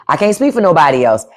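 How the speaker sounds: normal talking voice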